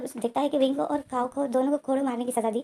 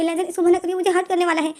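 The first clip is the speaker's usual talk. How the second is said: retold shout, higher and louder; crying out; 6 semitones higher, 6 dB louder than usual. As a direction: call out in panic to Lenjen. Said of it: "wing जो चिल्ला कर बोलता है". (Wing घबराकर लेनजेन को पुकारता है)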